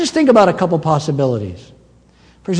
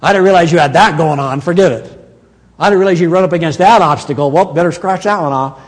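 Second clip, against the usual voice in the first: louder, quick, offhand backpedalling; mock careless god; 5 dB louder than usual. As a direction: play a flustered boss cancelling the plan. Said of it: "look at your life and go".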